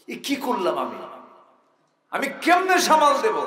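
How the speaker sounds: rising to a high, loud panicked plea; acted dismay. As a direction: voice a man overwhelmed by what he has done. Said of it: "মাথায় হাত দিয়ে বলে".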